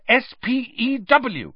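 high, slow indignant spelling; hotly. A narrator's reading. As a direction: correct them hotly, letter by letter. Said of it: "said Hermione hotly".

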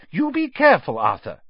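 high, loud sharp warning. Acted. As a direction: snap a warning at husband. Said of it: "said Missus Weasley sharply".

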